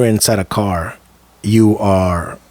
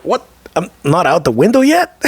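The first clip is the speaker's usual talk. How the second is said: higher, questioning disbelief; retold astonishment, pitch climbing; incredulous; 9 semitones higher than usual. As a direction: act out baffled surprise. Acts out his disbelief mid-flight.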